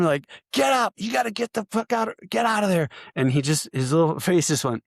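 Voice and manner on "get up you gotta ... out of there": high-pitched mock drill yell; imitated yelling. Cadre to soldier who won't get up, imitated yelling. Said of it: "they're yelling at him".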